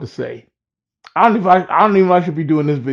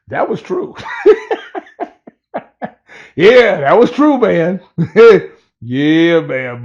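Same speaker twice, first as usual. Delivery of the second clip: laughing; 3 semitones higher, 4 dB louder, slower than usual. Real, not acted. Cracking up at his own joke.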